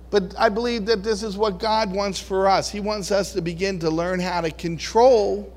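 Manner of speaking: ordinary delivery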